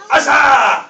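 loud, sharp martial arts shout from the gut; demonstrating the kiai for the kids. Shown as a demonstration. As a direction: bark it out like a karate yell.

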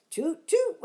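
high loud toot; loud mock toot; boat horn. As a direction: hoot like a boat. Danny and dinosaur hoot.

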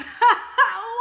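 loud, high-pitched laugh; burst of laughter. Real, not acted. Viewer joking about funeral arrangements, bursting out laughing. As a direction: break into a big high laugh.

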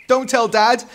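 high, loud desperate plea; despairing cry. A narrator's reading. Eric pleads with Mum, despairing cry. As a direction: cry out, begging. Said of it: "Eric cried in despair".

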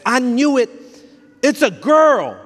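high, loud triumphant exclamation; excited announcement. Retold or imitated. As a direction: announce the girl like you called it.

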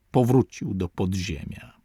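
plain reading voice

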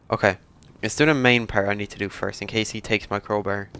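normal talking voice

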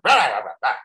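loud gibberish bark; Rob's barking. Retold or imitated. Imitating how former boss talked, mock barking. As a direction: imitate someone barking every word. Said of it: "everything he said was like".

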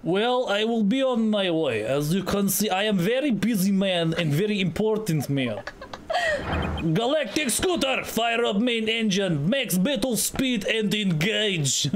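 put-on self-important Russian accent; pompous Russian commander giving orders; Russian space director. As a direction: act a grand Russian captain.

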